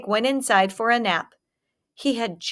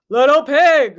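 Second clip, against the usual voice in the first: loud, rough wolf calling at the door; a big bad wolf growling, loud; hungry wolf growling at the stick house; 4 semitones higher, 8 dB louder than usual. A narrator's reading. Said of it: "he growled".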